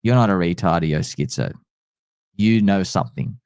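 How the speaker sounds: low, murmured impression of a strange texter; mock cryptic messenger. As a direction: voice the weird message in a low, knowing tone. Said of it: "I'm like".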